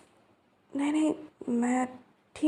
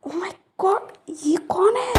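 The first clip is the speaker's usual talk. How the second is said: startled; 8 semitones higher, slower than usual. Acted. Sudden gang appears.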